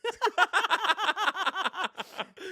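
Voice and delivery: high burst of laughter. Real, not acted. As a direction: laugh out loud, high and bright. Laughing at own joke.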